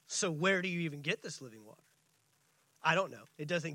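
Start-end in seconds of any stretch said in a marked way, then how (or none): 0.1-1.8 sarcastic. Shown as a demonstration. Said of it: "Or maybe she's asking it sarcastically".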